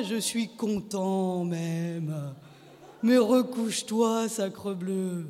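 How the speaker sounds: slow, amused husband coaxing; drawn-out, gently mocking calm; mocking, cheerful. A narrator's reading. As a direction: tease her along slowly. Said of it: "moqueur et gai".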